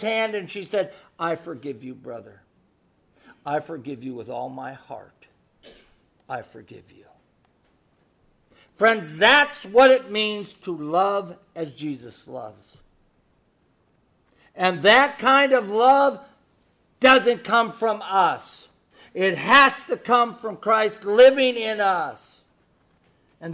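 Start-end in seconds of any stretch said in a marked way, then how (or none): 1.2-7.1 soft, hushed, sincere; tender forgiveness. Retold or imitated. Woman forgiving the man who hurt her, tender and hushed. 14.5-22.4 slow, loud, emphatic preaching. Real, not acted. Pastor urging the congregation, slow and forceful.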